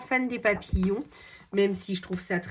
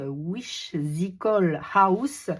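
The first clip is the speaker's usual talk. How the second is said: slow, careful French-accented English; much slower than usual. Real, not acted. Vlogger retries the English book title, slow French accent. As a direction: pick through the English words with a French accent. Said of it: "désolée pour mon accent anglais".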